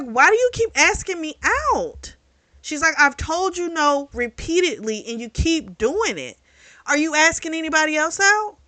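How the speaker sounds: mock exasperated rant at the pushy boss; lost it. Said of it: "she lost it".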